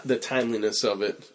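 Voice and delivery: ordinary delivery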